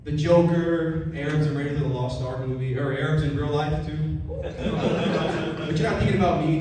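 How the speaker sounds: ordinary delivery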